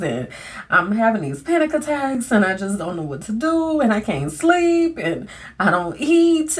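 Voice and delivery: high, strained, near tears; high and breaking, overwhelmed; tearful pleading. Retold or imitated. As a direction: spill it all out while crying. Retelling her breakdown in tears.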